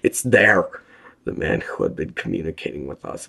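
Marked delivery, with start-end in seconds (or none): 0.0-0.7 loud terrified cry; sobbing prisoner shrieking at what he sees. A narrator's reading. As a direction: shriek it in terror. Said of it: "the sobbing prisoner shrieked".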